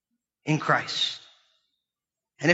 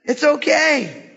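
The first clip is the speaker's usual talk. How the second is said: 1 higher admiring voice; 8 semitones higher, slower than usual. Retold or imitated. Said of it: "He's like".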